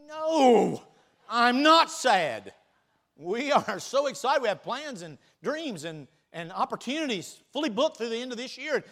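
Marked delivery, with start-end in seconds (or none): 0.1-4.4 emphatic denial. Real, not acted. Answering "aren't you sad?.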